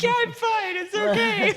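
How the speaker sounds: very high, loud squeal of insisting through tears; mock tearful. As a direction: imitate yourself crying while claiming to be fine. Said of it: "I started crying at one point".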